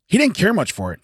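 ordinary delivery

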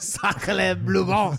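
slow, loud mock French cry; French accent. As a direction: stretch it like a cartoon Frenchman. Mocking French exclamation.

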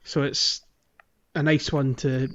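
ordinary delivery

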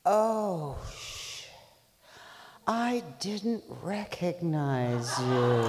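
slow, embarrassed booming reply; sheepish God. Acted. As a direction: caught out, drag it sheepishly. God caught out, punchline.

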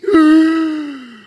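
loud, high gasp of fake shock; mock shocked gasp. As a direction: gasp as if scandalized. Preacher reacts to racy verse.

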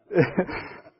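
short breathy laugh; real chuckle. Real, not acted. Teacher laughing after ribbing a student, breathy chuckle. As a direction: let a quick laugh slip out.

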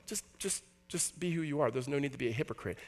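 soft, half-whispered plea to drop the act; softly, almost breathless, just be yourself; quiet pleading voice; hushed gentle correction. Retold or imitated. Jesus gently correcting the performers.

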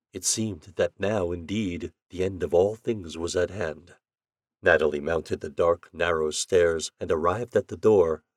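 ordinary delivery, nothing marked

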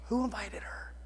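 hushed, unvoiced mock whisper; whispered aside. Onlooker reacting to the yelling lady, whispered aside. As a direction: whisper it like a baffled bystander.

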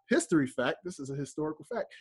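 ordinary delivery

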